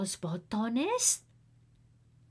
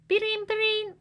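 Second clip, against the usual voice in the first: high sung ring of a phone; phone ring sound; 13 semitones higher, 4 dB louder, faster than usual. Retold or imitated. Narrator imitates the phone ringing, high and bright.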